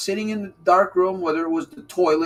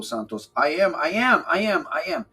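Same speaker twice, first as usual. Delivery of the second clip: ordinary talk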